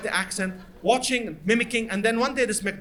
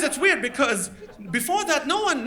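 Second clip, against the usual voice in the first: ordinary delivery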